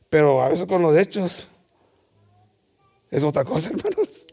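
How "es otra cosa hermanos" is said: ordinary delivery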